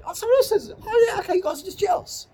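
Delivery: very high falsetto mock realisation; mock dawning realisation. Realising the critics are just jealous, in a very high falsetto. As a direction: squeak it out like a cartoon.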